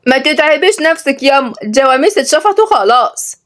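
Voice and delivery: put-on high-pitched sly little girl. A narrator's reading. Girl hints to mother about empty buffaloes, sly. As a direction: tattle slyly in a high child's voice. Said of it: "وروحية قالت بمكر".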